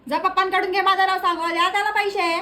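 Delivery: acted mother hollering an order; calling out. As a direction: call it out to someone in another room. Mother calls out to father.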